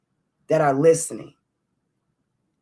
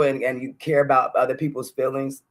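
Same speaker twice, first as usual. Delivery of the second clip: ordinary talk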